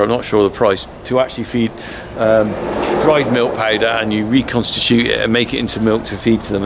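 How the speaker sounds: usual speaking voice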